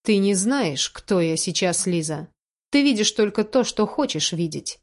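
plain reading voice

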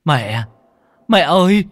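loud, high cry out to mother; hét lên. A narrator's reading. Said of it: "thì hét lên". (hét to gọi mẹ)

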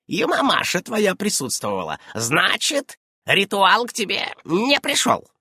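high, animated witch voice; high-pitched, lively old witch. A narrator's reading. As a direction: exclaim over a discovery, then press the question.

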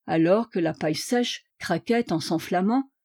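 usual speaking voice

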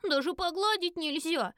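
put-on high, drawn-out childish complaint; whining little girl. A narrator's reading. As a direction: whine it slowly, high-pitched. Child complains about being slapped away. (обиженное детское нытьё, высоко и протяжно)

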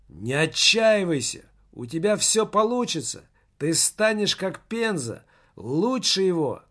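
high-pitched, mildly shouted encouragement; narrated cry. A narrator's reading. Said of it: "крикнул Немаркетинг".

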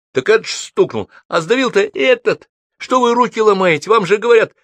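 возмущённый крик на высокой ноте; криком втолкуй, кто виноват. A narrator's reading. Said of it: "закричала". (возмущённо кричит)

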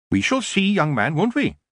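high, testing lilt; knowing old witch. A narrator's reading. Witch shopkeeper sizes up the young man, knowing. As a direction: lightly tease him as a sceptical witch.

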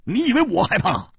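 high, indignant retort; 怒气冲冲地反驳; angry. Acted. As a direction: bristle and fire back high. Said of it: "胡铁花怒道".

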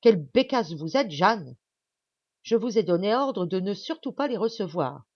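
loud, exasperated telling-off; angry scolding. A narrator's reading. Doctor to the maid who disobeyed his order, angry scolding. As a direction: scold her sharply and loudly. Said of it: "gronda Alburtin".

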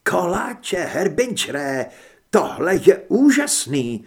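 high and loud, savouring "úžasný"; rapturous, drawing it out; ecstatic. Acted. Heather ecstatic about pie.

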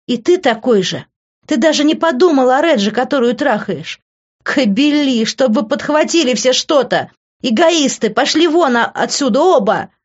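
high, loud woman's rant; shrieked outrage; furious tirade. A narrator's reading. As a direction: lash out and order them both away. She screams at both men.

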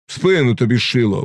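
ordinary delivery, nothing marked

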